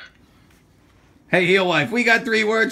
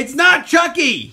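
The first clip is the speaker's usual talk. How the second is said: slow, loud, insistent; insisting, drawing it out; 9 semitones higher, 7 dB louder, slower than usual. Real, not acted. Insisting in an argument.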